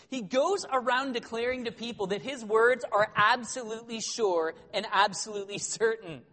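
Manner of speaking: ordinary delivery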